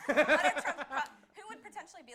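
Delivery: loud high laughter; laughing. Real, not acted. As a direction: burst out laughing. Laughing at Omarosa joke.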